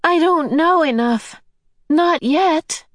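put-on high, anguished boy's voice; helpless and desperate. A narrator's reading. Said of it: "cried in an anguished voice".

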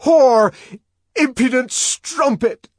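high, loud enraged bellow; furious shout, high and loud. Acted. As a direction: spit the insult out in fury. Savage shakes her in rage.

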